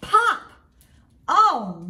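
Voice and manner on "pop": sharp loud pop; popping sound. Acted. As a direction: make the button pop. Button pops off in story.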